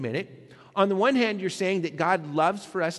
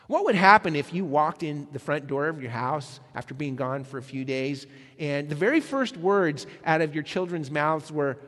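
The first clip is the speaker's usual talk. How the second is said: ordinary talk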